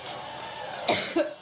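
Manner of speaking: dry hacking coughs; coughing fit. Real, not acted. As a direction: cough hard, stopping the speech. Coughing at the podium.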